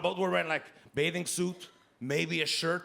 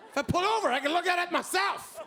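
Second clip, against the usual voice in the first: exasperated yell; 10 semitones higher than usual. Acted. Comedian yelling back at his girlfriend, exasperated. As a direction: throw it back at her, fed up. Exasperated comeback, high loud.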